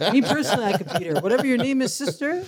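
playful, laughing taunt; laughing tease. Real, not acted. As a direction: tease her while laughing. Laughing, teasing the runner.

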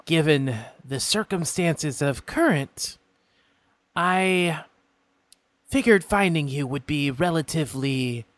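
a polite, curious imp stranger; imp folk voice. Acted.

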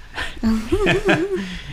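high-pitched laughing burst; high burst of laughter. Real, not acted. Laughter after joke.

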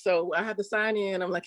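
ordinary talk, nothing marked

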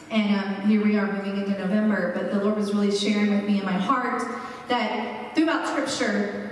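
ordinary talk

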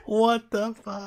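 high, drawn-out shocked outburst; high-pitched mock shock; stunned disbelief. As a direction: re-enact being floored, slow and loud. Acted stunned reaction in retold chat.

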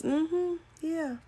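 acted uninterested "uh-huh" tone; distracted acknowledgement. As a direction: answer absently, as if busy on the phone. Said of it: "you doing this".

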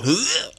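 high retching gag noise; imitated gag. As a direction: mimic the aunt's disgusted gag. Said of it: "I was gagging. Like my aunt".

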